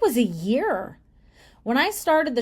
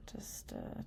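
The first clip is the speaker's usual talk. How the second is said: whispering; 21 dB quieter than usual. Real, not acted. Fading aside.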